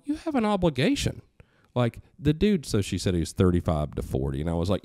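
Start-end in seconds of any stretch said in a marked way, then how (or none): 0.1-2.7 high, forceful insistence; insistent lecture. Retold or imitated. Father lectures his daughter on her duty, insistent. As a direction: lay down the duty firmly. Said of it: "I'm like".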